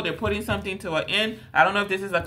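ordinary delivery

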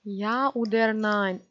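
langsam und lauter; ungeduldig gedehnt. Real, not acted. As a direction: zieh „Ja oder nein" fordernd. Drängt Figur ungeduldig. (gedehnte, drängende Frage)

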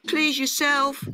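high grasshopper call, huffy. A narrator's reading. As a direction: call after someone walking off. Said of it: "called the grasshopper".

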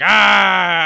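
a sudden loud angry yell; Sonny bellows his rage at the news; angry shout. Retold or imitated. Enraged brother yelling over his beaten sister, loud. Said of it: "and Sonny gets mad".

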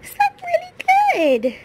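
very high, loud pet coo. Real, not acted. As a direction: gush at the pet. Cooing baby talk to pet.

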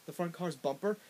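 usual speaking voice

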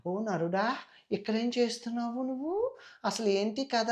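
a demoness turned beauty, sweetly calling the man; honeyed and alluring. Acted. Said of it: "sweet వచ్చేస్తది".

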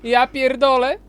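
exasperated outburst. Real, not acted. Gamer outburst at screen.

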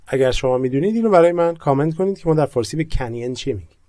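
ordinary talk, nothing marked

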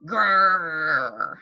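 low growly bear noise; bear growl. Shown as a demonstration. Teacher showing the class a bear's growl. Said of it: "tell me what sounds a bear makes".

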